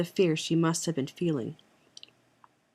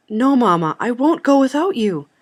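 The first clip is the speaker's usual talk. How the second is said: louder, higher pleading refusal; urgent protest; 6 semitones higher, 7 dB louder than usual. A narrator's reading. Daughter refusing to leave her mother behind, protesting urgently. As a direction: cry out in protest, voice raised.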